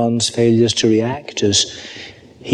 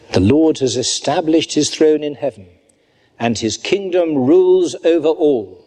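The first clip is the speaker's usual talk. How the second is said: rising proclamation; 4 semitones higher than usual. Real, not acted. A preacher closing the psalm, rising in praise. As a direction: proclaim it out, lifting to the end.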